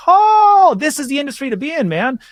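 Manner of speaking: high, loud "oh" settling into excitement; excited revelation. Retold or imitated. Musician recalling discovering the tribute money, excited revelation. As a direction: act out a big, awestruck realization.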